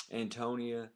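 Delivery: ordinary talk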